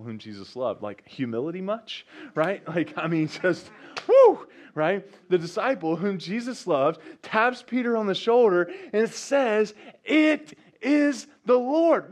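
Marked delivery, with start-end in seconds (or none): 3.3-4.5 high, loud whoop; playful woo. Real, not acted. Preacher to church, high playful whoop. Exclamation on humility. 5.3-9.7 high, loud and rising to the proclamation; preaching at full force. Real, not acted. Preacher to congregation, loud and high. 10.0-12.1 slow, high and loud, each word held; enthusiastic exclamation. Acted. John to Peter, slow high cry of joy. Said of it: "exclamation mark".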